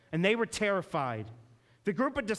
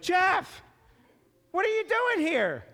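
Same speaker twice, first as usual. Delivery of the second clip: high, loud, amazed shout; the shocked father at the door, yelling; surprised dad; 12 semitones higher, 5 dB louder than usual. Retold or imitated. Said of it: "they were just so excited".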